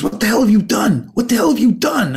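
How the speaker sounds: high, sharp angry yell at a client; a panicked, furious agent on the phone. Retold or imitated. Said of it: "He goes".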